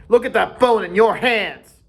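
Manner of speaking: taunting shout at a rival; mock him loudly. A narrator's reading. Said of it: "shouted Jack".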